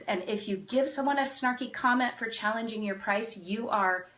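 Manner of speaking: ordinary delivery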